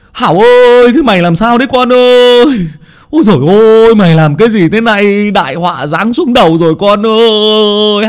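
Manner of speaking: high, loud anguished wailing; wailing lament. A narrator's reading. Old Hán collapses wailing at his son, loud anguished lament. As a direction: cry out in despair over the disaster. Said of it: "quỳ sụp xuống mà hét lên".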